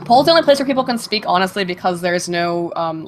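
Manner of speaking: ordinary talk, nothing marked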